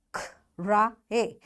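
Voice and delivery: "Cry" broken slowly into K, ra, ee; sounded out. Shown as a demonstration. Teacher breaks the example word down for pupils, sound by sound. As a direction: sound "Cry" out piece by piece with gaps. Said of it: "Long I sound".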